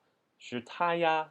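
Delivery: 是他呀 stretched long and high; drawn-out doubt. Shown as a demonstration. Teacher showing how it's drawn out, high doubtful 是他呀. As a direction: draw out 是他呀 in surprised doubt. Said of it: "it's a little drawn out".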